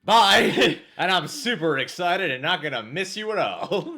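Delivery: high, loud, laughing goodbye; hammed-up careless farewell; mock cheery husband.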